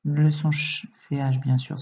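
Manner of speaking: normal talking voice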